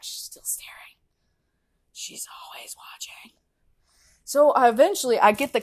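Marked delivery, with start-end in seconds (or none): 0.0-3.3 hushed, eerie whisper fading away; spooky whisper. Acted. Describing the staring lady, creepy fading whisper. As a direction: whisper it like a horror film.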